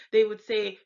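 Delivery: ordinary delivery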